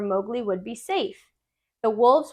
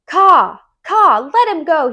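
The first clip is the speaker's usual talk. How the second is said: loud, high-pitched shout of alarm; 5 semitones higher, 9 dB louder, slower than usual. Acted. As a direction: yell at the snake to let go. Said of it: "he shouted".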